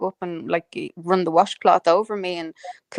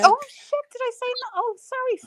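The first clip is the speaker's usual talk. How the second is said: much higher, startled apology; surprised oops. Real, not acted. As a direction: catch your own mistake with a yelp. Realises she missed someone.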